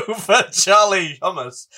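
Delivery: giggling through a silly name swap; laughing mispronunciation. Real, not acted. Amused co-host giggling over the actor's name. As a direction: laugh it out as a pun. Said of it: "No, stop, Rob. I can't".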